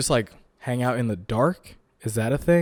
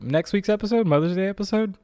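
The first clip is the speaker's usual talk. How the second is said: teasing announcer voice; 9 semitones higher than usual. Acted. Teasing next episode.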